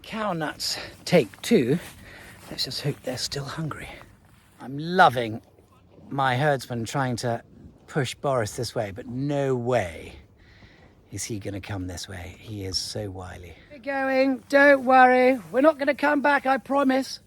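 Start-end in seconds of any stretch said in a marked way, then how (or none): 2.5-3.9 hushed whisper while hiding; whispering from behind cover. Real, not acted. Waiting quietly for cattle. 13.7-17.2 high, sing-song fake promise to the bull; coaxing fib.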